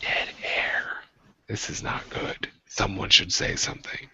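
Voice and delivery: hushed, soft whispered aside; stage whisper. Pretend. Whispering to co-hosts during dead air, mock alarm. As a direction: whisper into the awkward silence.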